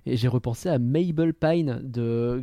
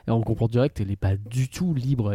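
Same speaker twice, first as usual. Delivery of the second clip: normal talking voice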